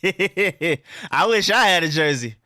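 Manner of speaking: slow words through a laugh. Real, not acted. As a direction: laugh while you say it. Laughing at own situation.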